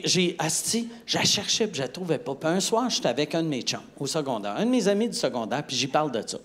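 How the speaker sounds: normal talking voice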